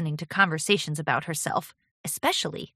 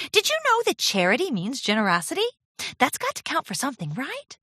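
lightly voiced, higher, hopeful pony; eager apprentice; 8 semitones higher than usual. A narrator's reading. Apprentice seeking credit for generosity, eagerly. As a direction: fish for approval in a raised voice. Voices Charity fishing for approval.

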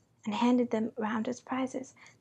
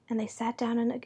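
ordinary delivery, nothing marked

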